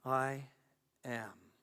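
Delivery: low and soft, with space between words; hushed refrain. Acted. Speaker inviting listeners to repeat the refrain, hushed and low. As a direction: lead them gently in a low voice.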